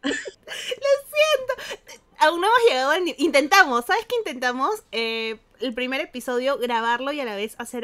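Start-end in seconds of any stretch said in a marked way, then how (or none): 0.0-1.9 high, drawn-out apology through real laughter; real giggling fit, words stretched and high; laughing apology. Real, not acted. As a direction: apologise while helpless with laughter. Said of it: "matándose de risa".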